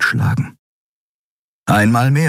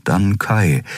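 plain reading voice

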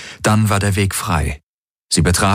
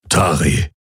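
lightly darkened man's voice; dark voice; 2 semitones lower than usual. A narrator's reading. Hawks addressing guard Tari, dark voice. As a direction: say the name in a dark tone. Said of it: "Es war Hawks dunkle Stimme".

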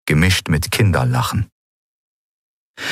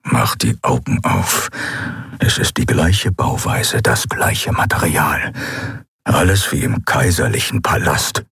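low calm Keru; 5 semitones lower than usual. A narrator's reading. Keru voiced lower.

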